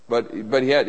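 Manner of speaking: ordinary talk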